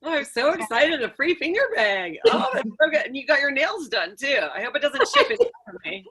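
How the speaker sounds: high, gushing and thrilled; mock excitement. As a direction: gush over the prize. Excited about free finger bang.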